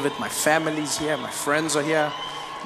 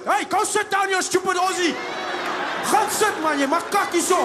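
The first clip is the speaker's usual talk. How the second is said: angry shout; 11 semitones higher than usual. Retold or imitated. Afrikaans guy yelling at the Australian, furious shout. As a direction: bark him back into his seat.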